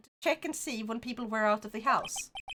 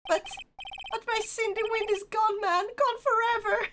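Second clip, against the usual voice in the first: hammed-up wailing; 9 semitones higher than usual. Voicing Larry's lament.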